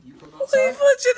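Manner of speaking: high, loud playful jab; excited teasing shout. Real, not acted. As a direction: tease him loudly. Teasing friend about to chug.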